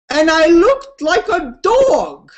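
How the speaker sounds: high, drawn-out quoted lament; mock anguish. Woman recalling her scream, slow and aghast. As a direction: say it slowly and aghast at yourself. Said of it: "And her words were".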